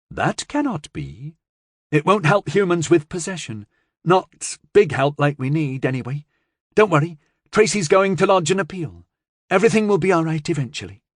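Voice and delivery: a hint of an eager girl; lightly voiced girl, hurrying to reassure; lightly voiced young girl. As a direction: speak like a chatty young girl. Child Jay speaks.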